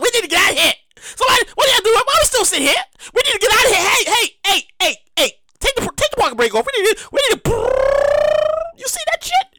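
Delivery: high frantic alien voice; mock alien panic. Aliens scramble to escape Earth, high and frantic.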